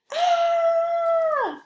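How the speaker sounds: loud shrill scream; high scream. Acted. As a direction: scream in fright. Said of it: "yelled Carrie".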